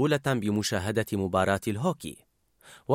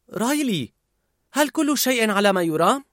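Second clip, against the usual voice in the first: higher, concerned ask; worried mother; 8 semitones higher than usual. A narrator's reading. The mother asking Riley, worried. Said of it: "سألتها الأم بقلق".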